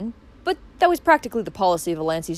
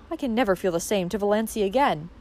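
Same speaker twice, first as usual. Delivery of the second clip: plain reading voice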